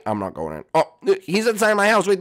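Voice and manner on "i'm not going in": put-on low, soft childish Jeffy voice. Jeffy answers his parent, low sulky kid voice. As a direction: mumble it like a stubborn kid.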